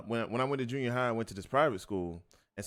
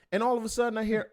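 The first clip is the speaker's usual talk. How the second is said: ordinary delivery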